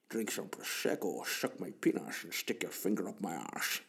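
a quiet, lightly voiced Scottish Bond; Sean Connery impression. Bond murmurs to Moneypenny in a Scottish accent. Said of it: "Sean Connery, anything with an S".

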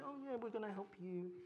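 soft, condescending helper voice; patronizing. Retold or imitated. Minister talks down to people, patronizing and soft. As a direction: talk down to them kindly. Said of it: "in kind of a patronizing way".

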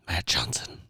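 low creepy whispered name. Pretend. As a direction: say your name like a horror host. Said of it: "nice and creepy".

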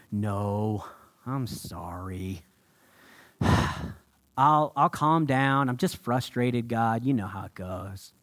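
soft, low, slow contrite apology; meek apology. Retold or imitated. Jonah humbly apologizing to God, soft and contrite. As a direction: sigh and calm yourself down. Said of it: "You'd expect Jonah to say".

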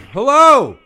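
loud, high-pitched hello; parent on speakerphone. Real, not acted. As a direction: call out hello into the phone. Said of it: "am I on speakerphone?".

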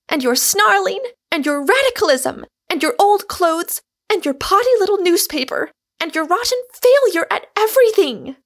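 high, loud cyclone of scorn; wounding tirade. A narrator's reading. Rosamond attacks Michael's ways and failures, furious wounding tirade. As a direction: let loose, speaking every word to wound. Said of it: "speaking every word to wound".

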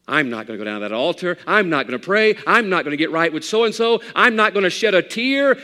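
loud, high stubborn defiance; defiant refusal. Acted. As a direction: refuse God with stiff pride. Proud sinner voiced.

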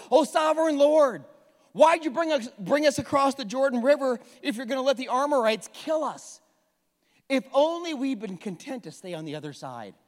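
loud pleading cry to the Lord; crying out to God. Retold or imitated. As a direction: plead out loud, half-despairing. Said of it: "Joshua cries out to God".

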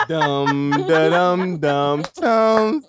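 loud pounding heartbeat sounds; heartbeat noises. Retold or imitated. Kid's heart pounding at the teacher's question, loud thumping sounds. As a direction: beat it out like a racing heart. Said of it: "my heart was like".

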